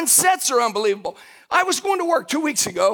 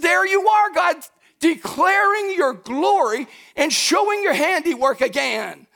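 exuberant praise; 5 semitones higher than usual. Retold or imitated. Preacher reliving praise to God on the drive to work, exuberant. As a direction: call out praise, full of wonder. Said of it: "I was praising God the whole way".

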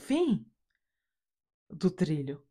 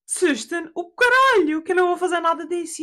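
loud, high, exasperated comeback; mock outrage; 12 semitones higher, 7 dB louder than usual. Daughter refuses father's dolphin scheme, mock outrage. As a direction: throw it back at him in hammed-up indignation.

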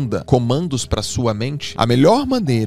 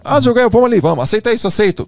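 high, louder wheedling friend; hammed-up coaxing buddy, pitched up; pushy friend imitation; 10 semitones higher, 5 dB louder, faster than usual. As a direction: mimic a friend pressing you to say yes. Friend pressuring him, voiced.

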